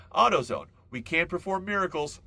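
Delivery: loud, high ad-jingle tagline; punchy fake commercial tagline; mock slogan. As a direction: announce it like a cheesy slogan. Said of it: "That should be the slogan".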